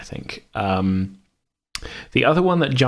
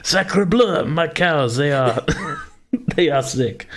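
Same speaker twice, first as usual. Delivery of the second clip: put-on over-the-top French accent, pitched up; hammy French farmer despairing over his cows; 8 semitones higher, slower than usual. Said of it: "we apologize to any French listeners".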